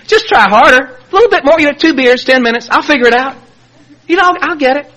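high, eager self-bargaining; mock-optimistic promise in a high voice; pleading self-talk. Acting out his drinking self-talk.

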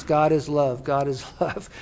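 low, hushed repetition; soft bedtime mantra. Retold or imitated. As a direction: recite it softly to lull yourself to sleep. Repeats his bedtime mantra.

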